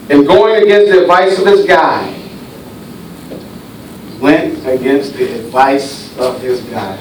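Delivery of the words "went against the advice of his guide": softer, lower repeat. Acted. Preacher repeats line for effect.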